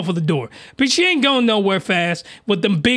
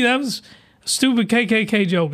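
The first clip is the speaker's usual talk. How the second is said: usual speaking voice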